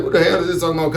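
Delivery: normal talking voice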